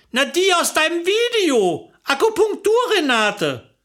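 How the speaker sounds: high-pitched, impatient reminder; mildly exasperated with a friend; exasperated explaining. Acted. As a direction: spell out the obvious, pitched high.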